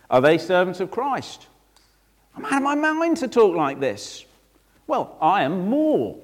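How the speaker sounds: high-pitched, incredulous mock boast; madman's boasting. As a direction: boast as if slightly out of your mind. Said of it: "I'm speaking like a madman now".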